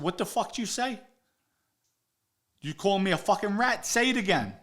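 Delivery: high-pitched, indignant confrontation; retold angry challenge. Jumping up at Vinny over being called a rat, angrily. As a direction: square up and dare him to repeat it.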